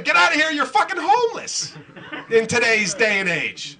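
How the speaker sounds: hammed-up scornful yell; mock angry dismissal. Acted passer-by rejecting the bionic man.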